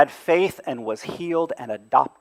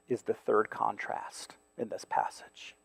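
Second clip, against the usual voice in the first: hushed whisper to the congregation; 6 semitones lower, 18 dB quieter than usual. Real, not acted. Preacher whispering a key point to the congregation. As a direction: drop it to a whisper.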